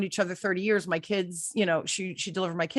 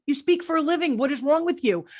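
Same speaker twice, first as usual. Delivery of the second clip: raised, higher-pitched mock exasperation; exasperated husband; 7 semitones higher, 4 dB louder than usual. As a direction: imitate a husband baffled by needless nerves. Retells husband's exasperated reply.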